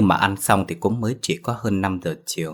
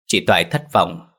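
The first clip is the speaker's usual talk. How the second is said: ordinary delivery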